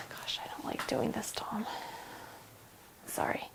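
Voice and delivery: real whispered distress, barely audible; overwhelmed whisper. Real, not acted. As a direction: whisper it, overcome and wanting to stop. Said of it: "I'm kind of freaking out".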